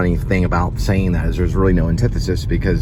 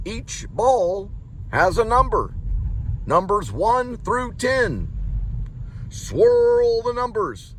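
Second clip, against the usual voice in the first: high, slow deadpan announcing; drawn-out flat counting ritual; imitating David Lynch; slower than usual. Imitating Lynch number show.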